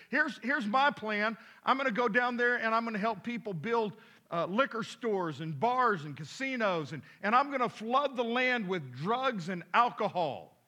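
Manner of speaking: loud, high gleeful demon; a devil shouting his big scheme; excited devil boasting. Acted. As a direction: crow it out like a proud devil. Second devil boasts plan.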